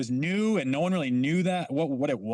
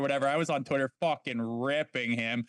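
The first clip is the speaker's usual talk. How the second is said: normal talking voice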